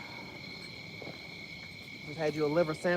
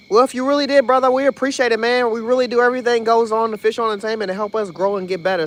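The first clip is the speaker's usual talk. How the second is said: excited thanks; 7 semitones higher, 8 dB louder than usual. Real, not acted. Thanking donor on stream.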